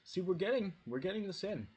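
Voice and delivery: high, loud delighted call; excited. Real, not acted. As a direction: burst out with glee. Excited about the dogs joining in.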